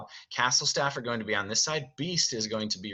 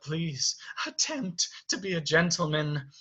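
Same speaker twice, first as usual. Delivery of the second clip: higher, pleading servant; Cogsworth; 7 semitones higher than usual. Acted. Servant urging Beast to be a gentleman, pleading. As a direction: politely urge good manners. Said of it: "Kaiser says".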